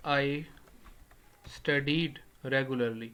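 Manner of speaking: very slow, studied regularly spaced out; dictating an example; slow example sentence. Shown as a demonstration. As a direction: read the example for note-taking. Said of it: "اگر میں اس کو ایسے بولتا ہوں".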